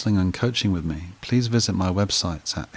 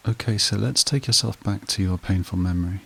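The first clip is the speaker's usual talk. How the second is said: even, level meditation voice with long pauses; guided meditation. Acted. Therapist guiding listeners back through a painful memory, guided meditation voice. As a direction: guide the listener steadily, leaving long silences between prompts.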